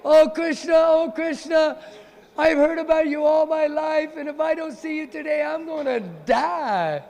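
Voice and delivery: high, loud wailing call, stretched out; a disguised girl wailing loudly for Krishna; lovesick yelling. Acted. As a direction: cry out his name to be heard in the village. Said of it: "walks along the border of Barshana yelling".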